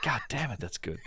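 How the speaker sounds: laughing through the words; amused and impressed, laughing; laughing delight. Retold or imitated. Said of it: "It's like".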